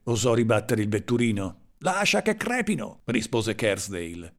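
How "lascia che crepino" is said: high-pitched, agitated reply; heated retort. A narrator's reading. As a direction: overrule the driver, pitch sharply raised. Heated retort to protesting driver. (replica concitata, in tono acuto)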